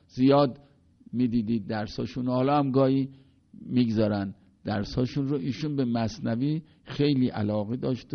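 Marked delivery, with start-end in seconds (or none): none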